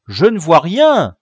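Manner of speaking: high, loud report; loud lookout's call. A narrator's reading. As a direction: shout it down from a treetop. Lookout calls down from tree.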